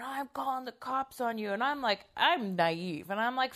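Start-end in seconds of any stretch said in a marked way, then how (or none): 0.0-1.5 mild, high mock yell; mock screaming threat. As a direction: mimic a kid freaking out, without shouting. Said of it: "he started screaming and freaking out".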